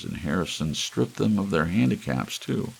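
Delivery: ordinary delivery, nothing marked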